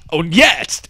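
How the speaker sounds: normal talking voice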